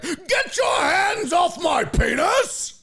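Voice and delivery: very high, drawn-out mock outrage; hammed-up, ringing orator's protest; Jack Carlson. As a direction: cry out theatrically as if wrongly arrested. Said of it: "I'd be like".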